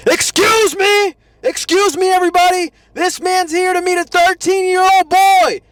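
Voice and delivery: very high, loud announcement to everybody; hammed-up high-pitched shouting; over-the-top scream. Shown as a demonstration. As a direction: scream it out like a public callout. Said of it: "I'm not saying to scream it".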